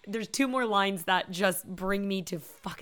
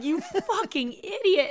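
slow, high-pitched exasperated quote; drawn-out mock disbelief; mock exasperation; 11 semitones higher, slower than usual. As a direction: draw it out, imitating someone fed up. Voicing the others' exasperated reaction.

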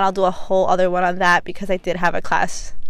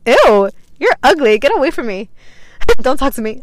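higher, louder mock disgust, with laughter; 4 semitones higher, 4 dB louder than usual. Turning down a man who offers Henny, loud mock disgust. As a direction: recoil from him playfully. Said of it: "I'm like".

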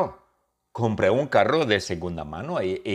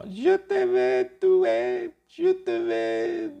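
high, slow falsetto wail in French; haunt them in drawn-out French; spooky French ghost moan; ghost speaking French; much slower than usual. Acted. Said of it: "lo dice en francés".

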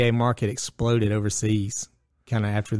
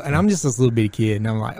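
normal talking voice

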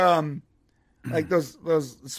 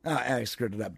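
usual speaking voice

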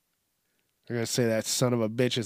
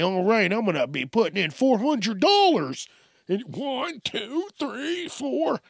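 high-pitched mock money counter; oblivious ATM customer; 9 semitones higher than usual. Customer counting cash at open window, high oblivious mock voice. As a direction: play the clueless customer in a high voice.

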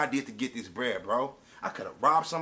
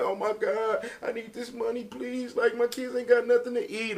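higher, hammed-up begging voice; fake-cry like someone faking tears for cash; mock crying plea; 7 semitones higher than usual. Mocking people who beg for money, higher mock sobbing plea. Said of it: "came over there crying like".

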